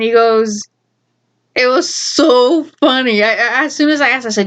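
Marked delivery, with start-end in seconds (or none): none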